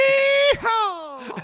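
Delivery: high, loud whooping yeehaw. Pretend. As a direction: holler it like a rowdy cowboy. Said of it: "redneck Egyptians".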